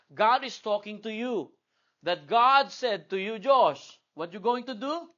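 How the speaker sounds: high, loud preacher's charge; preaching at full force. Acted. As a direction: thunder the word of God at the kids. Preaching to the children. (masigasig na pangangaral)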